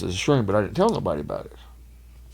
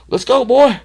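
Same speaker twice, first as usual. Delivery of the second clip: high, loud jeering call; mock loud command; 14 semitones higher, 9 dB louder, slower than usual. Big guy hauling the drunk around, high loud jeer. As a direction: yell it like dragging a dog along. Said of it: "jerking him".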